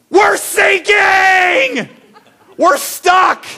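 high, loud and drawn-out panic; panicked shout. Retold or imitated. Boys stuck sinking in the swamp, shouting in panic. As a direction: yell like a kid sinking in mud.

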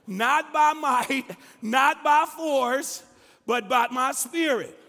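loud, high, slow proclamation; forceful scripture preaching. Acted. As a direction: drive each phrase home to the congregation.

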